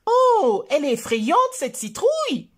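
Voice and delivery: put-on high, loud child's exclamation. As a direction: exclaim in excitement at the pumpkin. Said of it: "s'exclame Choupi". (exclamation enfantine, plus aiguë et forte)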